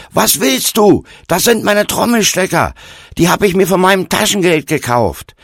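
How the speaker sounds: high, loud, desperate protest; desperate shout. A narrator's reading. Steini defending his drumsticks to the stranger, shouting desperately. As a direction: cry out in desperate self-defence. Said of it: "Steini rief verzweifelt auf den Hof".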